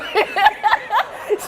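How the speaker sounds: high-pitched imitated chuckle; Martine's little laugh. Friend laughing on the phone to loosen up a panicking bride, little high laugh. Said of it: "she does this little laugh".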